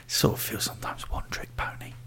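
a whispered aside, barely voiced; confiding whisper to the co-host. Real, not acted. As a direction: drop to a whisper for the jab. Muttered aside about the actor.

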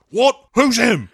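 high, loud, baffled outburst; Bond. Acted. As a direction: bark the question in mock confusion. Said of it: "And he's like".

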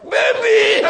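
high excited shout to wife; excited call. Retold or imitated.

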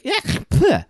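high-pitched mock spit noise; "yeck-pleh" spitting sound; imitated spitting. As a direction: imitate someone spitting at a captor. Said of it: "and spits on him".

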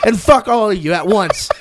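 loud, high ranting at a cartoon cat; mock rant. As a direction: rant at him like he's a lazy cat.

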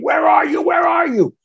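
high-pitched mock scream; imitating a frantic wife yelling down the phone; retold screaming. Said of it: "she starts screaming at me saying".

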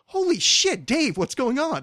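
high, slow, startled holy shit; astonished recognition. Retold or imitated. Old high-school girlfriend spots Dave in Alexandria, high and astonished. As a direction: spot an old friend in disbelief. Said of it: "someone walks up to them and goes".

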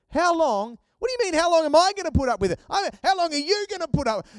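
high, loud, rapid-fire exasperation; indignant challenge. Retold or imitated. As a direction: throw the question back, loud and incredulous. Acts out exasperated challenge from God.

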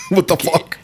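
high, shocked, half-voiced gasp of a curse; panicked swear. Dexter freaking out after the killing, panicked curse. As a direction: blurt it out in terror.

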